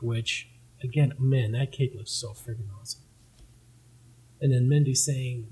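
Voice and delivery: usual speaking voice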